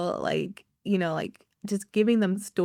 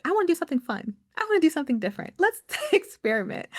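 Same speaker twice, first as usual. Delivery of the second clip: eager and excited; 8 semitones higher, much faster than usual. Retold or imitated. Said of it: "I was like".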